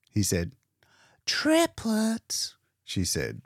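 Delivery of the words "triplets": much higher, light astonished echo; a mild excited gasp of a word; exclaiming. Acted. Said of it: "She was exclaiming".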